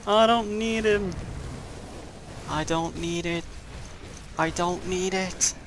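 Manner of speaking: slow, repeated mock refusal in a put-on voice; mock denial. Gamer talking to himself, fake-stubborn mock refusal. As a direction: insist you don't need it, unconvincingly.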